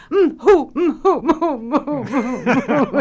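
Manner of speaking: high, loud "mm, hoo" sobs sped up, breaking into laughter. Retold or imitated. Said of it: "Now say it faster".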